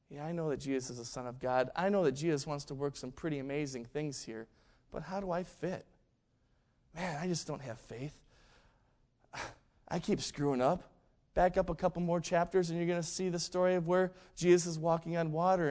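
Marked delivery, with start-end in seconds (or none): 0.1-10.9 soft, subdued inner monologue; self-doubting thoughts. Retold or imitated. Imagining Peter doubting his place, quietly self-doubting. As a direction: think it aloud quietly, doubting yourself. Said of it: "imagine what's going through his mind".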